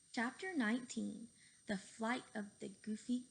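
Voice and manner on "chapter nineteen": usual speaking voice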